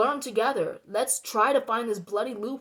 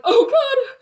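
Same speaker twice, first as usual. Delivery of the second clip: imitated nervous laugh-cry, pitched very high; 12 semitones higher, 7 dB louder than usual. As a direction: squeak it out like an anxious bystander. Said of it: "just nervously like".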